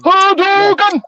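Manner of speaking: high, slow, drawn-out battle cry; Street Fighter shout. Pretend. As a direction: yell it like a fighting game move. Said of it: "Ken doing Street Fighter moves".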